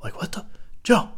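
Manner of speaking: high, soft whisper; stunned whisper. Retold or imitated. Fallen friend in the bush calling softly to Joe, whispering. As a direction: breathe it out in disbelief. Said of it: "he's going".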